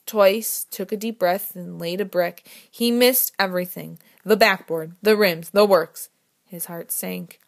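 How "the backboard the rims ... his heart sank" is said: ordinary delivery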